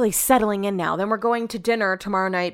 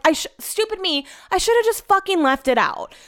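much higher, self-scolding; frustrated with herself; 8 semitones higher than usual. Real, not acted. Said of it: "I'm so annoyed".

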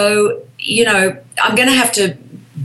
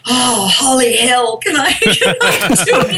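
comic panicked aside with a laugh; mock panic; 4 semitones higher than usual. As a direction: play flustered nerves, then crack up. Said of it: "And then I'm like".

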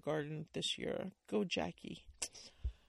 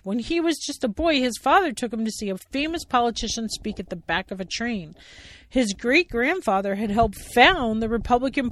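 loud, higher storytelling; children's play narration; story narration; 6 semitones higher, 13 dB louder than usual. A narrator's reading. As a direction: read it out to kids. Narration read.

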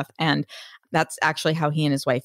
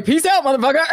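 higher, louder, laughing sign-off; laughing mock farewell; 8 semitones higher, 4 dB louder, faster than usual. To a co-host, joking about fleeing the aisle, laughing cheeky goodbye. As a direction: toss it off through a laugh, cheeky.

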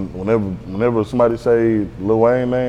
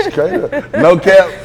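laughing; 8 semitones higher, 7 dB louder, slower than usual. Real, not acted. Laughing at own toilet story.

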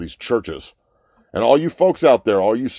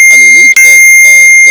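much louder, slowed, blaring "these folks are"; sudden outburst; 2 semitones higher, 18 dB louder, slower than usual. Real, not acted. Ranting at faith-healing believers, then a loud slow blast. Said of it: "sorry about that".

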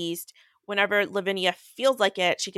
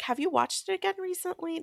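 teasing question; 12 semitones higher, 4 dB quieter than usual. Retold or imitated. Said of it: "I was like".

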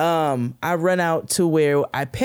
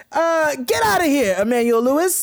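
high, louder brush-off, growing more shrill; brushing off a pest; 11 semitones higher, 4 dB louder than usual. Retold or imitated. To a five-year-old chasing her, high dismissive brush-off. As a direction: shoo away the pestering kid. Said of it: "I said".

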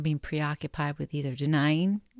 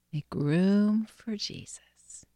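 whispered breath prayer; 5 semitones higher, slower than usual. Real, not acted. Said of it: "pray this breath prayer".

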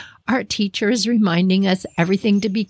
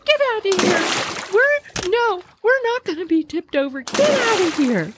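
timid friend turned fierce; 11 semitones higher than usual. Retold or imitated. Madeline joins the threat.

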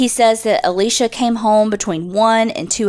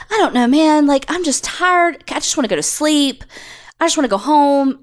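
put-on higher-pitched, put-upon teen complaint; annoyed teenager; 5 semitones higher than usual. Podcaster imitating a tired suspect to detectives, as an annoyed teenager. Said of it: "sounds like an annoyed teenager".

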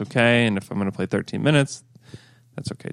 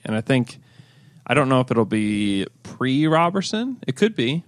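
ordinary talk, nothing marked

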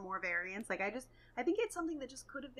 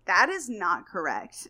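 pedantic commenter; 8 dB louder, slower than usual. Retold or imitated. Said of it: "they'll be like".

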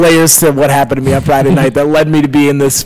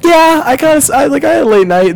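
sheepish excuse; 11 semitones higher than usual. Retold or imitated. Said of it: "I was like".

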